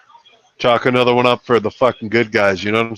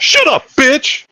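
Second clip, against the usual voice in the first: high, drawn-out mock outburst; mock angry command; 11 semitones higher, much slower than usual. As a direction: act out a furious put-down, pitched way up. Acts out movie slap scene.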